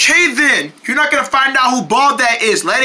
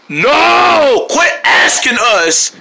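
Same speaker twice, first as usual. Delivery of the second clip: annoyed shout; 4 semitones higher, 3 dB louder, much slower than usual. Acted. Characters snap at repeated question.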